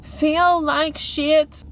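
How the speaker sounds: high, drawn-out dramatic moan; dramatic lament. Real, not acted. Vlogger overstating her bad mood, dramatic high lament. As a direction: stretch every word out high, milking the misery. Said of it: "I'm probably being very dramatic right now".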